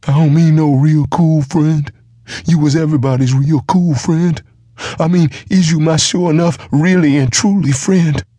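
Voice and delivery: put-on high, loud, childlike giant begging; simple giant demanding loyalty from the dwarf, high and loud; pleading giant half-wit. A narrator's reading.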